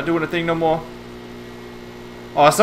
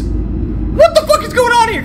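high-pitched, startled outburst; sudden high yelp of confusion; alarmed outburst. Real, not acted. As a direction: burst out high in bewilderment. Startled outburst during horror game.

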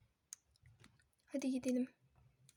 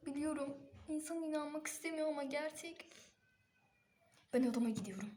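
usual speaking voice

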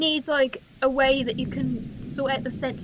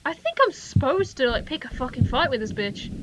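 usual speaking voice

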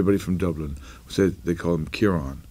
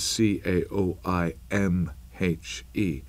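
spelling out slowly; much slower than usual. Shown as a demonstration. Explaining his cousin's Irish name, slow careful spelling. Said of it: "a cousin called Kiva".

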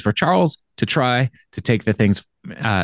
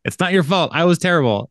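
higher-pitched teasing repeat of an apology; joking mock echo; 7 semitones higher than usual. Podcaster retelling Jake's sex-tape title joke, teasing higher echo. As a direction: lift it high like a joke title.

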